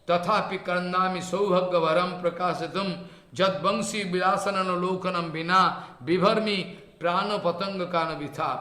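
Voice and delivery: intoned scripture with lengthened syllables; Sanskrit verse recitation. Acted. Teacher quoting Mahaprabhu's verse to listeners, chanted recitation. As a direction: chant the verse out in measured phrases.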